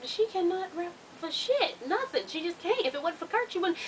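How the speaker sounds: mock the doubters under your breath; hushed sarcastic mimicry; mocking hater voice. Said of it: "everybody around here ... be like".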